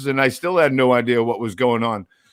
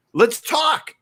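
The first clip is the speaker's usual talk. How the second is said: shouting; 9 semitones higher than usual. Real, not acted. Ranting about bans.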